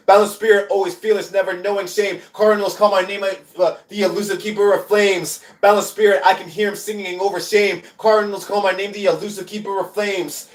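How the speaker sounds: spoken-word poem. Acted. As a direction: declaim it with a driving, steady beat. Performs a poem.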